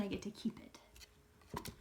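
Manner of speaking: put-on secret whisper to the kids; hushed treasure-hunter whisper. Shown as a demonstration. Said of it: "whisper to the kids".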